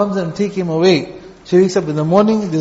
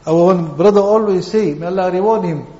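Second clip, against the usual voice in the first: usual speaking voice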